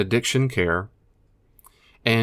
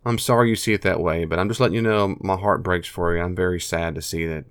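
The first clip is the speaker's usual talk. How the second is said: normal talking voice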